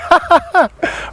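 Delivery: a quick high laugh at yourself. Acted. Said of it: "that laughing game".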